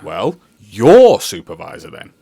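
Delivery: loud, pushy demand; stubborn shout. Acted. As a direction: snap it back at him. Driver being stubborn.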